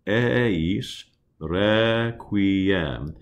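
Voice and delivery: "Eis requiem" with open E, as in "bed"; slow demo, open the E wide; open E vowels. Shown as a demonstration. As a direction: choir teacher shows open E. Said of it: "Those three E vowels are all what we call open".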